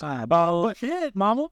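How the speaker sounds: slow, drawn-out teasing call of bullshit, high at the end; teasing a friend who's spinning a story, slow mock protest; mock disbelief. Real, not acted. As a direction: stretch it out like you're calling his bluff.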